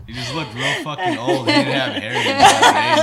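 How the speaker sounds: talking through big laughs; laughing. Real, not acted. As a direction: laugh while you talk. Laughing through teasing reply.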